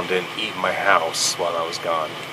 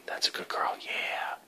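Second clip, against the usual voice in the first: soft whispered dog praise; whispering to a dog; 12 dB quieter than usual. Real, not acted.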